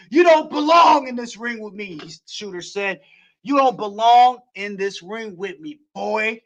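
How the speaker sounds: excited wrestling play-by-play; play-by-play call. Acted. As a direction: call the match like a ringside announcer.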